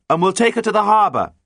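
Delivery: high, loud, firm call. A narrator's reading. Captain tells pirates where the prize goes, calling out. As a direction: call the decision up to them. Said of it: "called John".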